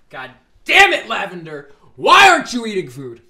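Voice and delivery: high, very loud scolding; exasperated yelling. Real, not acted. Scolding Lavender for not eating food, exasperated yelling. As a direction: yell it in sheer frustration.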